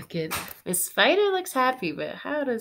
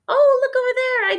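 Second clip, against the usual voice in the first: much higher, louder surprised cry; delighted gasp; 11 dB louder, faster than usual. Acted. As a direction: gasp and point it out. Gasp at spotting the car.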